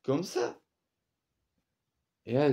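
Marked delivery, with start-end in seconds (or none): 0.0-0.6 startled huh. Retold or imitated. Baffled by the airport staff's directions, a shocked high yelp. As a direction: jolt up in pitch, totally lost. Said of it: "Et je dis".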